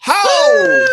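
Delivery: very high, loud cheer; hype shout. Real, not acted. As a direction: whip the crowd up with a big shout. Host hypes the crowd.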